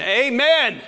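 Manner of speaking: imitating a church man. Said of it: "loved to say".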